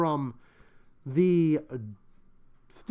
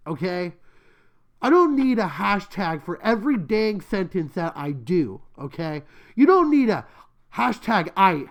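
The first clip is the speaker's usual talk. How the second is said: exasperated, emphatic ranting with louder bursts; angry rant; 3 semitones higher, 3 dB louder than usual. Real, not acted. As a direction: rant about too many hashtags, hitting the words hard. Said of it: "I'm so angry about this".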